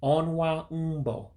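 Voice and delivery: closing slow model of Onwa Umbo; final model. Shown as a demonstration. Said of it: "one more time as we end".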